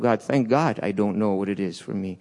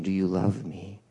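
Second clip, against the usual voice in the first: quieter, lower gentle asking; soft repeated question; 5 semitones lower, 7 dB quieter than usual. Retold or imitated. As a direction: ask again gently. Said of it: "But he asks again".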